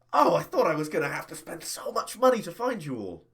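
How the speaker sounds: high, excited exclamation; delighted relief. Acted. Boss finding the hackers' killers for free, delighted.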